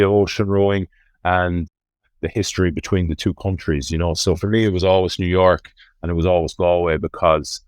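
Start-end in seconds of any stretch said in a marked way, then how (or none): none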